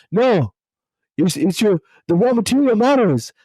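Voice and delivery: put-on high, emphatic French-accented voice; Philippe Lemoine's French accent. Quoting the philosopher's point to the host, mock French accent. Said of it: "he says it in a French way".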